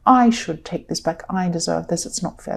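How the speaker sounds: ordinary talk, nothing marked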